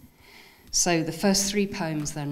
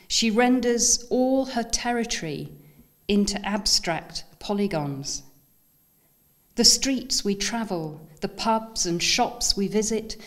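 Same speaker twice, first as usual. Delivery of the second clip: lifted, deliberate recital, pausing after each image; measured poem voice, each phrase set apart; poetry reading; 3 semitones higher than usual. Acted. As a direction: recite it line by line to an audience. Reads second poem aloud.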